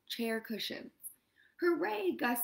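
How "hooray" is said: higher, brighter hooray of two happy mice; cheering. A narrator's reading. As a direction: cheer it out in delight. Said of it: "Gus and Jack cheered".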